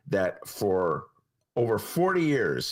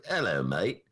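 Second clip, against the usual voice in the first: lower-pitched mock British accent; 6 semitones lower than usual. Shown as a demonstration. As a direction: toss off a quick British accent. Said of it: "I could do a British accent".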